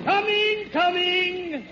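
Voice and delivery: high sing-song call to the door. Acted. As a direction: call cheerily to the visitor knocking. Santa answers the door knock.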